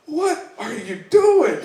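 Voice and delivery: high, slow, incredulous what are you doing; drawn-out disbelief at a clueless newcomer; mock old inmate. Old inmate asks the Icelander, slow and incredulous.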